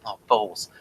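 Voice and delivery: normal talking voice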